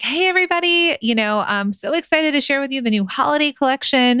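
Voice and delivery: high, excited sales-pitch voice. Acted. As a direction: hype up your followers like an influencer. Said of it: "That's, like, my sales voice".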